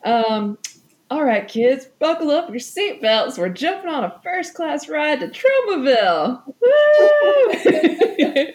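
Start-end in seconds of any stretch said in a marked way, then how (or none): 1.1-8.5 higher, louder, excited host call; booming kids show opener. Acted.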